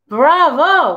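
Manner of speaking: cheering bravo; boy cheering the coyote's song; shout. A narrator's reading. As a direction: call out bravo with joy. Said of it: "I shout".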